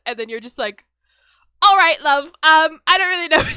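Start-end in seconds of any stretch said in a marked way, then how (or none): 1.6-3.4 loud, high mock-British, breaking into laughter; crappy British accent. Spouse answering a divorce request, hammed-up British accent. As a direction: put on a bad British accent, loudly. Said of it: "I'd go with a British accent".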